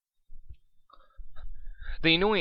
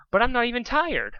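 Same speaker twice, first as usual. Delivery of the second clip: protesting; 11 semitones higher, 4 dB louder than usual. Acted. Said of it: "protests Spike".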